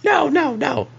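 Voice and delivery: high falsetto denial; quick, high-pitched reassurance; Clarice reassuring. Acted. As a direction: wave it off in falsetto. Game master plays Clarice reassuring.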